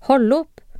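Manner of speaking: a light read-aloud shout, not full force; narrated call to stop. A narrator's reading. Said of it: "Helge på det andre laget roper".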